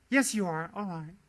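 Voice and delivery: ordinary delivery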